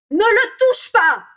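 furious, very high drawn-out shout; roaring. A narrator's reading. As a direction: roar to keep him away. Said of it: "rugit Bellatrix". (rugissement furieux)

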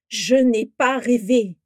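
loud, slow, each word weighted; emphatic insistence. A narrator's reading. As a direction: hammer out a firm denial. Orion insists against being doubted. (en martelant chaque mot, fort)

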